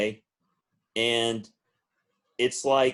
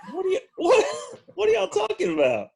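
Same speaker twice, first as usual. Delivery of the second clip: put-on baffled teen; 3 dB louder than usual. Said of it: "And I was like".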